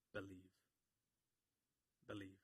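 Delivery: very soft and low, with long pauses; hushed key word. Real, not acted. Preacher reveals the repeated word, hushed. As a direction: let the word drop quietly into silence.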